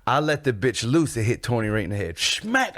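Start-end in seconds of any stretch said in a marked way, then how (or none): 2.2-2.7 high-pitched smack sound effect; comic-book hit, pitched high; imitated impact. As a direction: punch out the word as the blow lands. Mimics the hit landing.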